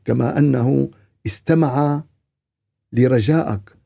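ordinary talk

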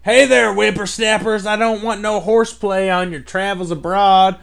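put-on high, loud old-timer scolding the kids; old prospector. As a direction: play a cranky old-timer. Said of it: "somebody that's, like, seventy years old".

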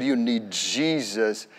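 low, soft and slow; soft low earnest plea; earnest and gentle. Retold or imitated. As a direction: tell her kindly, with quiet conviction. Leading her to Jesus.

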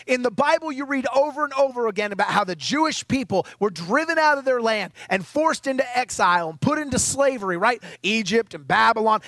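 ordinary delivery

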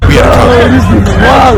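high, drawn-out mock shout; exaggerated high-pitched bellow; hammed-up confrontation. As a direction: blow it up into a silly yell. Hammed-up version of the man's confrontation.

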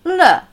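le said high and loud; light final le. Shown as a demonstration. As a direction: pop the le at the end. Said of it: "aber das Ende". (le am Ende)

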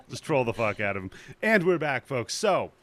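normal talking voice

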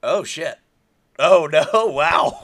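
loud, high, hammed-up dismay breaking into laughter; mock-horrified outburst; retold shock. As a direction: re-enact being caught off guard, loud and high. Re-enacting shocked reaction on rewatch.

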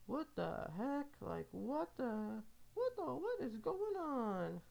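hammed-up disbelief from a stranger watching; mock baffled onlooker. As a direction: play a confused bystander staring. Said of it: "they're gonna be like".

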